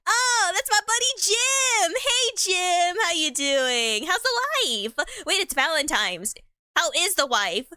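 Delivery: high, slow, cheery hello to a buddy; mock delighted to see an old friend; excited greeting. Greets in-game character Jim.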